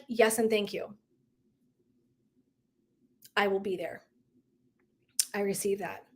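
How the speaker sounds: soft, low, sincere receiving; calm acceptance. Retold or imitated. Speaker accepts a friend's retreat offer, calm and sincere. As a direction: accept quietly, with long pauses. Acts out graciously accepting the gift.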